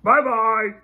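papa bear calling farewell, gruffly; big gruff bear voice. A narrator's reading. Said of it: "she heard a big gruff voice".

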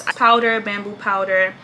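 usual speaking voice

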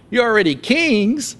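high mocking praise; sarcastic. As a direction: pretend to admire them, dripping sarcasm. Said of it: "And Paul gets sarcastic".

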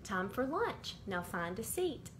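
usual speaking voice

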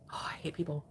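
exasperated sigh. Real, not acted. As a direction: sigh it out under your breath, fed up. Exasperated sigh after ranting at commenters.

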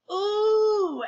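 long, high "ooh"; a loud, drawn-out crowd ooh. A narrator's reading. Everybody reacts to the contest news, oohing. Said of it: "everybody oohed".

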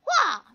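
high, loud yelp on impact; pained yelp. Real, not acted. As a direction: yelp like you got hit. Hit in game.

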